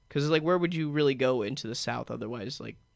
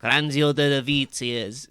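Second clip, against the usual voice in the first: slow, lightly put-on Italian accent; imitating the coach's Italian accent, slowly; old Italian manager; 6 dB louder, slower than usual. Mocking the old Italian manager at the microphone, slow Italian accent. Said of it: "his voice kinda sounds like".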